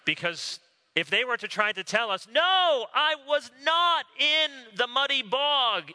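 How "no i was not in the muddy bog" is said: put-on high, loud childish denial; child denying. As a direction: play the guilty child protesting. Child denying being in mud.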